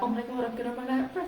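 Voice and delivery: high, hurried panicky gabble; mock panic. Coach acts out a speaker running out of breath, panicky. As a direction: rush it out as if air is running out. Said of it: "then you start to panic".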